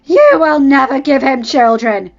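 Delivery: loud, slow curse; wife cursing her rival. Acted. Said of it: "as if in a curse".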